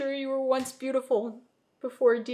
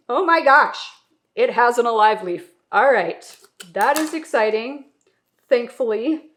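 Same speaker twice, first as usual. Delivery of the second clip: excited; 3 semitones higher, 11 dB louder than usual. Real, not acted. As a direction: burst out thrilled at the find. Finds alive leaf.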